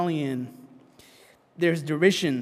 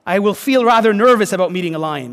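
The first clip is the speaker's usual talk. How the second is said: nervous Susan; 2 semitones higher, 7 dB louder than usual. Retold or imitated. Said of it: "said Susan".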